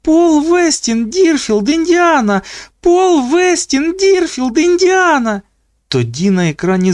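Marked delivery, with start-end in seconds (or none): 0.0-5.4 високі розмірені вигуки; скандуй протяжно, високо й завзято; гучне скандування. A narrator's reading. Скандування гасла з екрана. (високе протяжне скандування)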